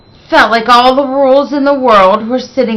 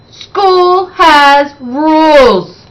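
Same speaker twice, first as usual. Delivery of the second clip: slow, loud, higher rule laid down; shouted; 7 semitones higher, 4 dB louder, much slower than usual. Acted. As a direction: drag out every word like a final verdict. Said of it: "shouted Miss Trisk".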